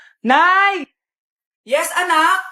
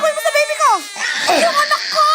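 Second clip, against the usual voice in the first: frantic shout; 9 semitones higher than usual. Acted. Mother screaming over stolen baby.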